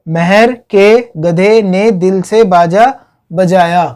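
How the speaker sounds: slow word-by-word repeat for learners; slow, separated words. Shown as a demonstration. Teacher repeating the crop memory trick to students, slow and separated. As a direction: spell out the phrase one word at a time.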